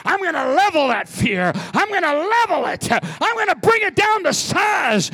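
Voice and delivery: high, loud fervent preaching; preaching at full force. Real, not acted. Preacher to congregation, fervent full-force preaching. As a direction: build to a shouting climax.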